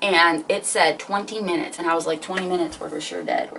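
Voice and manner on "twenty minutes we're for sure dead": low, dropping, defeated mutter; quiet hopeless groan; despairing mutter. Retold or imitated. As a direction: mutter it like you've already given up. Stranded, panicking about the wait.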